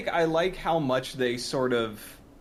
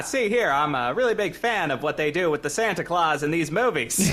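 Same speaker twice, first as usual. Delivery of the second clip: put-on louder old-timey newsreel voice; 1940s voice; 4 semitones higher, 5 dB louder than usual. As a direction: talk like a 1940s movie wiseguy. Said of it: "You need to talk in your nineteen forties voice".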